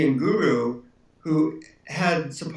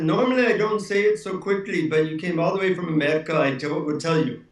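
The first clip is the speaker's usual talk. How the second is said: put-on Indian accent; 2 semitones higher than usual. Guru making an exception for the traveler from America, Indian accent. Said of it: "in his Indian accent".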